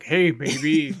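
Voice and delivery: cocky action-hero one-liner; lightly voiced swaggering tough guy; Duke voice. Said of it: "your best Duke voice".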